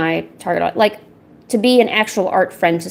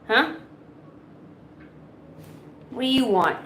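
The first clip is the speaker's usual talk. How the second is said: lightly raised coaxing questions to the cat; pet talk; 4 semitones higher, 4 dB quieter than usual. Real, not acted. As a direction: ask the cat playfully.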